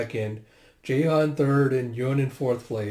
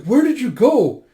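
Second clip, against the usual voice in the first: high, loud worried question; urgent demand; 10 semitones higher, 10 dB louder than usual. Retold or imitated. Asking the one who ran off, urgent and loud. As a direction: call out, demanding an answer.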